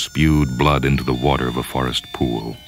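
ordinary delivery

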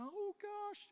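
imitating wife's joy. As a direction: mimic her happy sigh of wonder. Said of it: "just like that".

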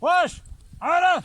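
high, loud shout to the animals; calling out loud. Real, not acted. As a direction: yell it across the field. (yüksek sesle bağırarak)

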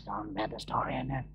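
low, very soft imitation of damaged vocals; lost voice. Man with lost voice starting the lesson, faint and low. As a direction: struggle through it with hardly any voice. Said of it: "he starts out there".

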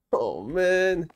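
normal talking voice

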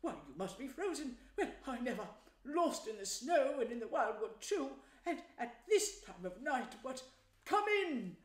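fussing, astonished host voice; welcoming Badger. A narrator's reading. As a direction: usher frozen friends inside, ending on a loud high call. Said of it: "in quite a different voice".

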